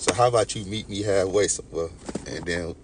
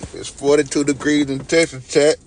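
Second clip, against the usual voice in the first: louder and higher, proclaiming "forty-two degrees"; loud announcement; 6 semitones higher, 8 dB louder than usual. Shown as a demonstration. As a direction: shout the news to chat. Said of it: "repeats the line".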